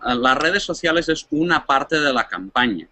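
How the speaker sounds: normal talking voice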